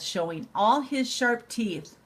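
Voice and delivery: ordinary delivery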